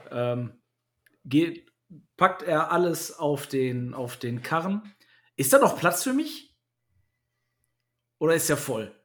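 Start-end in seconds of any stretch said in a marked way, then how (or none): 5.3-8.9 deutlich höher gesprochene Figur; hoch und fragend als Figur sprechen; hohe Figurenstimme. Pretend. Figur fragt in hoher Stimme. (hohe Figurenstimme)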